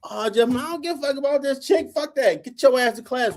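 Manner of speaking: imitating himself yelling at a young line brother; retold scolding. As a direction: chew the kid out in the hallway. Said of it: "All I heard was".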